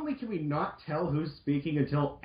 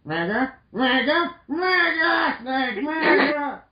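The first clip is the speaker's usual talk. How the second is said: frantic calling; 9 semitones higher, slower than usual. Acted. Roll searching for Mega Man, hammed-up frantic cries. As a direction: call out in rising panic.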